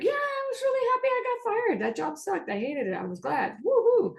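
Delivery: high, chirpy mock cheer with a whoop at the end; mock happy fired worker. Therapist imitating someone glad to be fired, cheering. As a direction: play someone thrilled to lose a job. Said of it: "some people might be like".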